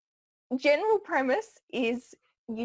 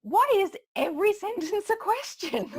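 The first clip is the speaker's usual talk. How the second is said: much higher and louder, rising on "question"; mock upspeak; 12 semitones higher, 5 dB louder than usual. Host mimicking reality TV talkers, exasperated high upspeak on "question". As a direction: send the pitch way up through to "question". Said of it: "everything goes up".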